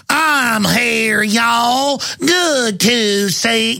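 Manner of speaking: slow, drawn-out mock Kentucky drawl; mocking impression of a put-on Kentucky accent; exaggerated Kentucky accent. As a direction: stretch every word in a hammed-up Kentucky twang. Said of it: "She talks in the accent".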